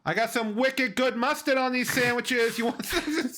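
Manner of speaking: high-pitched, hammed-up Boston deli man; Boston accent. Transplanted Bostonian offering sandwiches, mock Boston accent. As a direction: push the sandwiches in broad Boston. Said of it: "talks like that".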